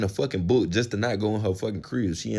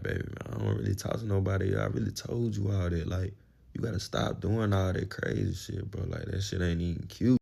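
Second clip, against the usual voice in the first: quiet; 7 dB quieter than usual. Retold or imitated. Soothing her, talking low.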